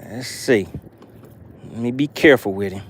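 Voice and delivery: normal talking voice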